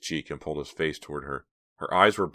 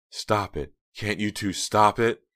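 shouting; 5 semitones higher, 4 dB louder, slower than usual. A narrator's reading. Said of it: "shouting at both of them".

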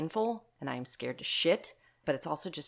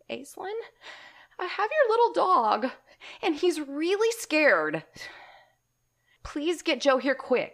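much higher, pleading, slow tearful voice; retold tearful plea, high and slow; tearful neighbor; 11 semitones higher, 5 dB louder than usual. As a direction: plead through tears, imitating the neighbor. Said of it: "now she's in tears".

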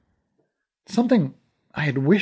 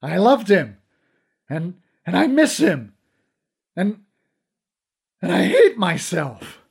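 breaking down; 6 semitones higher, 6 dB louder than usual. Acted. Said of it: "I broke down and wept".

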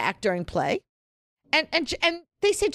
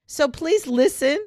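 mock warning; 3 semitones higher, slower than usual. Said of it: "the trigger warning".

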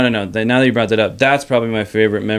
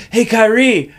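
calling out; 11 semitones higher, 5 dB louder, slower than usual. Retold or imitated. Calling out on field.

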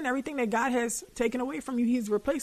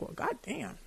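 muttered sarcastic retort, much softer; under-breath retort; 13 dB quieter than usual. Retold or imitated. To herself, scolded by a book: soft sarcastic grumble. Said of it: "And I'm like".

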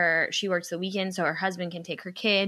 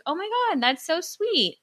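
much higher gushing thanks; touched surprise; 10 semitones higher than usual. Retold or imitated. Speaker thanking the woman for her present, high and gushing. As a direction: gush like you've been handed a gift. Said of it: "And I was like".

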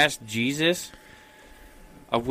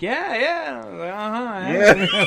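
higher-pitched, unconvincing agreement; imitating someone badly faking agreement; bad liar; 7 semitones higher, much slower than usual. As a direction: play along like a terrible liar. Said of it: "He's so bad at lying".

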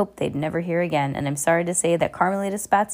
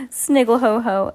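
higher, louder sniggle; mock shriek laugh; 5 semitones higher, 5 dB louder than usual. A narrator's reading. Esme gloating, a higher mock laugh. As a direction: squeal it gleefully. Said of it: "Esme shrieked".

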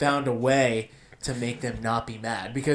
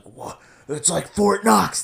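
higher, louder, drawn-out mock amazement; imitating old customers; 6 semitones higher, 5 dB louder, slower than usual. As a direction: play the old folks delighted by their own joke. Imitating old customers' stock joke.

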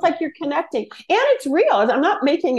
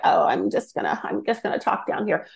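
Kardashians impression; 5 semitones lower, 5 dB quieter than usual. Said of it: "sounding like the Kardashians".

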